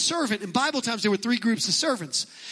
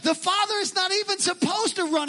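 higher, insistent objection; protesting Pharisees; 8 semitones higher than usual. Retold or imitated. Preacher voicing Pharisees objecting to Jesus, higher and insistent. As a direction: play the Pharisees objecting to Jesus, high and pressing. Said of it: "the Pharisees are going".

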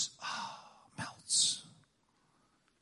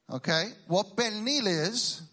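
ordinary talk, nothing marked

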